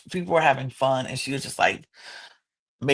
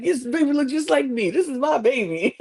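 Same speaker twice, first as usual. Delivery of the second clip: high, laughing proud boast; Nick's proud laughing claim; 10 semitones higher than usual. Retold or imitated. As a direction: show off the baby through a laugh. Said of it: "Nick is like".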